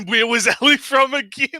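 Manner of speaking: asks through a loud laugh, pitch up; laughing the words out; laughing question. Real, not acted. Laughing while asking about Ellie.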